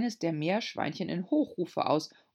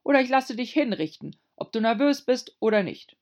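angry; 7 semitones higher, 7 dB louder than usual. A narrator's reading. King threatens angrily.

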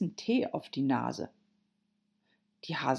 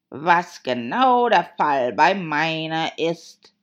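loud, slow Hatter voice; Hatter, emphatic; 9 dB louder, slower than usual. Acted. Hatter explaining his watch to Alice, loud and emphatic. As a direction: declare it loudly, drawn out.

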